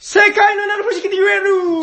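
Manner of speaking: high, loud announcer's shout; sustained high-pitched proclamation; excited title reveal. Real, not acted. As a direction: belt out the game title like a show host. Shouted reveal of fourth place.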